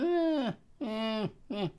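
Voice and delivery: high strained "eh" noises; imitated gurgly sucking noise. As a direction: mimic choking on a flooded vape. Imitates sucking juice through it.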